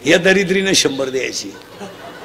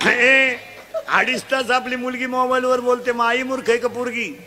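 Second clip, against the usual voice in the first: full-force preaching; 6 semitones higher than usual. Acted. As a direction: drive it home to the crowd. Quizzing the crowd loudly.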